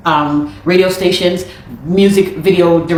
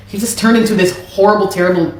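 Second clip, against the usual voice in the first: usual speaking voice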